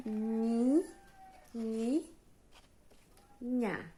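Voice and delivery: high rising "ni", then a separate "nha"; isolated syllables. Shown as a demonstration. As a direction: dictate "ni" and "nha" one syllable at a time. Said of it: "ela tem quatro sílabas".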